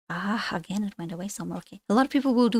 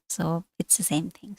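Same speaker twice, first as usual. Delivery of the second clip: a quick whispered aside to the class; 4 semitones lower, 6 dB quieter than usual. Real, not acted. Teacher whispering an aside to her students. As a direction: drop to a breathy whisper for the aside.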